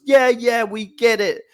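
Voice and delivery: put-on exasperated Jets fan in a heavy New York accent. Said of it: "a particularly annoyed Jets fan with a, with a heavy accent".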